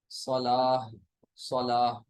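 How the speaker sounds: सलाह ending in h, no t; pausal ha. Shown as a demonstration. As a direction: let सलाह end on a long aa and h. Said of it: "सलातुन नहीं कहेंगे". (सलाह, आख़िर में हा की आवाज़)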